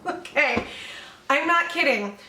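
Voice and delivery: ordinary talk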